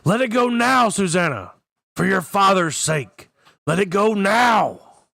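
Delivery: full-voiced, high, urgent yell; commanding shout. A narrator's reading. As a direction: yell an order with everything you have. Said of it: "Roland was shouting".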